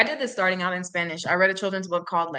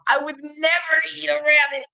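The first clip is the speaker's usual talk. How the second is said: defensive shout; 6 semitones higher than usual. Acted. The bear insists to the squirrel, defensive shout. Bear denies eating rabbit.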